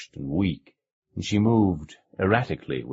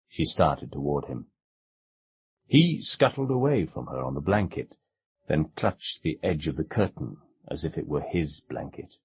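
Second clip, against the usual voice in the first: ordinary delivery, nothing marked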